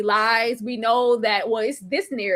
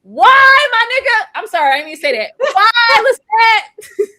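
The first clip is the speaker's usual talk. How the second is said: higher, louder wailing protest; betrayed outcry; 9 semitones higher, 7 dB louder than usual. Real, not acted. Fan betrayed by Lestat, wailing loud and high. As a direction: cry out like a betrayed friend.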